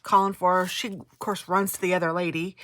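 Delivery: ordinary delivery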